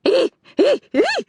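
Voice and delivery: repeated high ape shrieks; chimp screeches. A narrator's reading. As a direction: keep screeching like a panicked chimp. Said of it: "he screeched".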